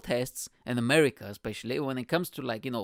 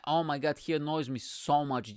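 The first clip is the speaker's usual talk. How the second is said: ordinary talk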